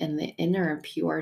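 ordinary talk, nothing marked

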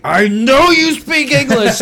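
high, loud, drawn-out call-out; accusing shout. Retold or imitated. Confronting Jose at the demolition, triumphant accusing shout. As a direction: holler it slowly to expose him.

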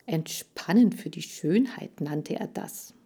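ordinary delivery, nothing marked

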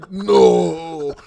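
loud hammed-up mock cry; mock wail. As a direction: wail it like a cartoon. Mocking friend's stereotype voice.

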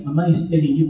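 ordinary talk

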